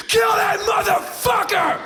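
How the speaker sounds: high, loud bloodthirsty shout; football fan yell. Retold or imitated. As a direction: roar it from the stands. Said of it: "They look at these guys and go".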